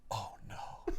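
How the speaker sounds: whispered horror, retold; hushed dismay. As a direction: barely voice it, like someone behind a stall door. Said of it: "he goes".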